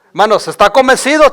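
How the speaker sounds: usual speaking voice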